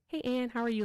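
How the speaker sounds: ordinary talk, nothing marked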